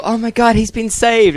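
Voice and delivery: mock relief. Mocking the typical rescue cliché, hammed-up and high. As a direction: overplay the cheesy rescue line.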